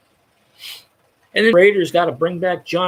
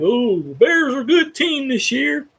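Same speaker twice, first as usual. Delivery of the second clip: higher, slow mock-naive tone; sarcastic imitation of fans; mocking quote; 8 semitones higher than usual. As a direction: parrot their claim with scorn. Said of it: "And they're like".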